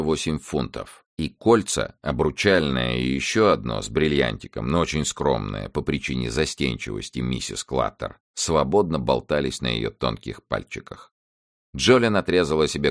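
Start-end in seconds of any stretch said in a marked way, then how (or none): none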